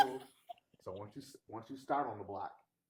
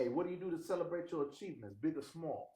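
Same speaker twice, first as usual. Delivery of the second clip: put-on higher, brash Bronx accent; New York accent; 7 semitones higher than usual. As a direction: go full Bronx on it. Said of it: "He went to Bronx with it".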